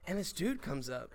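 normal talking voice

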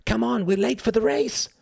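very high, hurrying holler; urgent calling. A narrator's reading. Badger hurrying Goose out, high urgent call. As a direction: urge them out in a high-pitched cry.

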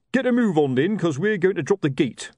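high, loud call to move on; sergeant's order. Acted. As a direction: bark the boat along. Colon ordering the boat.

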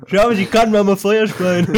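high, laughing playful boast. Pretend. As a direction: giggle it out, high and loud. Joking about firecrackers in mouth.

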